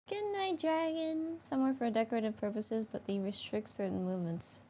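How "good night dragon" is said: high cutesy voice. Pretend. Voices goodnight to dragon.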